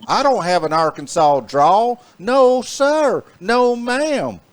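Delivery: high, exaggerated Arkansas drawl; jokey over-the-top Arkansas twang; mock Arkansas drawl. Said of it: "I don't have a drawl".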